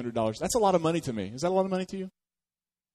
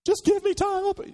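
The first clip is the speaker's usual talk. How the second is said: high, louder whiny plea for more time; mock pleading debtor; 3 dB louder than usual. Pastor mocking a debtor begging the electric company, high whiny plea. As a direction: squeak it out like a desperate debtor begging.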